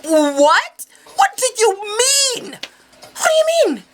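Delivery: high, drawn-out cry of disbelief; shocked shriek. Acted. Retelling shock at police arriving.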